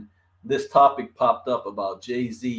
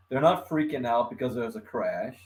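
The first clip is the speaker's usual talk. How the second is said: ordinary delivery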